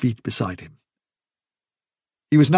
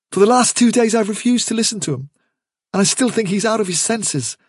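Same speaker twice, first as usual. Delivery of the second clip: higher, hurried earnest explanation; earnest Hazel; 6 semitones higher than usual. Acted. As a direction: reason with a friend, pitched up. Hazel explains himself to Bigwig.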